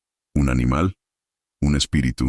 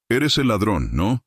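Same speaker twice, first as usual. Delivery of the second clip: raised-voice challenge; 8 semitones higher than usual. A narrator's reading. Official challenging the tied-up man, raised-voice accusation. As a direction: confront a caught thief with raised pitch. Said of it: "grité con la voz elevada".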